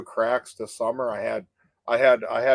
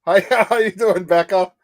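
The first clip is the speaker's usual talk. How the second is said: laughing; 7 semitones higher, 8 dB louder than usual. Real, not acted. Laughing greeting to Becca.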